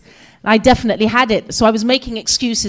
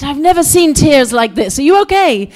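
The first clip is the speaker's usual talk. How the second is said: alarmed concern; 4 semitones higher, 6 dB louder than usual. Retold or imitated. Acting the alarmed driver's concern.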